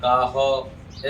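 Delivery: काह as "kaa-ho"; wrong short ending. Shown as a demonstration. Teacher shows what to avoid, काह as "kaa-ho". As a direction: end काह on a short rounded vowel. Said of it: "ऐसे नहीं".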